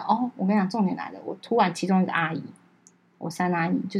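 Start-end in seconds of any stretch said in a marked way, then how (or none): none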